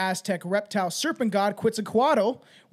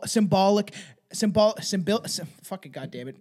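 ordinary delivery

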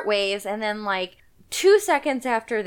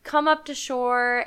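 ordinary talk, nothing marked

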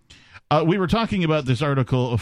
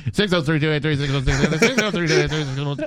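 auctioneer. Pretend. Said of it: "you should auctioneer the phone number".

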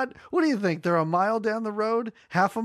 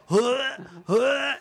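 light imitated retching huffs; half-hearted gagging noises; mock retching; 3 semitones higher, 4 dB louder than usual. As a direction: huff out a fake retch twice. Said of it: "he's like".

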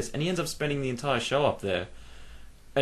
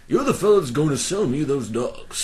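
louder mountain-man impression; put-on mountain-man voice; Coburn; 3 semitones higher, 5 dB louder than usual. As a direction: play a mountain man making his demand. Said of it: "typical mountain man".

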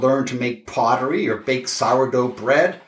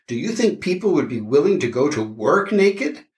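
ordinary talk

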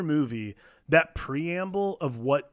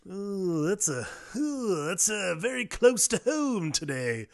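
uneasy ooh; 5 semitones higher, slower than usual. Retold or imitated. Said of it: "and I'm like".

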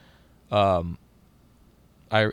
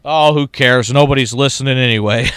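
loud, higher, dismissive mock shrug; careless announcer; 3 semitones higher, 11 dB louder than usual. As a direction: toss it off like a jaded radio man. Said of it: "he goes".

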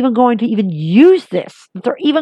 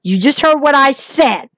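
ordinary talk

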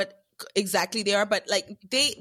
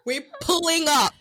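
mock tough family; 6 semitones higher than usual. Voicing family ready to fight.

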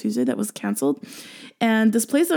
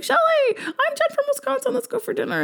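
high, quick, eager invitation; imitating an eager new friend; excited friend voice; faster than usual. Friend Jen quoted inviting her.

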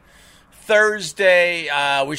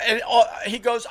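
ordinary delivery

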